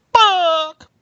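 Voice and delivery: high, loud, drawn-out squawks; yelling chicken. Acted. Chicken flinging books into the swamp, yelling in squawks. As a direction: squawk furiously like a chicken hurling things. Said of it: "the swamp while yelling".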